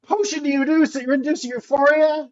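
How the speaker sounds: high, slow outraged rant; mock screaming. Potion brewer berating Anne over a bag of potion, mock screaming. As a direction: stretch out the scandalised disbelief, high-pitched. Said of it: "just be screaming".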